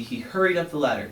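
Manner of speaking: usual speaking voice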